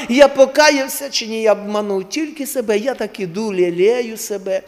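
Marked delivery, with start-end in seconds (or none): none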